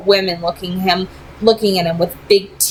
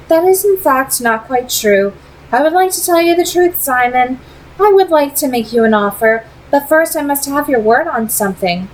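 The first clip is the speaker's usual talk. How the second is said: put-on higher, poised vampire lady with a faint accent; Camille; 6 semitones higher than usual. A narrator's reading. Vampire leader tempting Simon with an offer, poised and higher. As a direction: speak as a cool, persuasive vampire leader.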